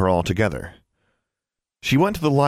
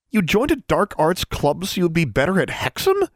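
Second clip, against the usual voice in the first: high-pitched incredulous outburst; acted sputter of astonishment, pitched way up; 10 semitones higher than usual. A narrator's reading. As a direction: blurt it out in high disbelief. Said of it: "Alexandra sputtered".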